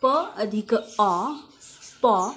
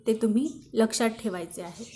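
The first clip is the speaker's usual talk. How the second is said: usual speaking voice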